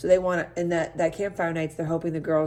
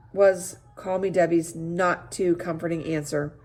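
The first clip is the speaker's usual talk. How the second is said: plain reading voice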